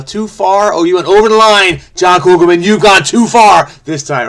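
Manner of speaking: hammed-up mock reprimand; mock scolding. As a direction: pretend to scold the constructor. Mock scolding the puzzle maker.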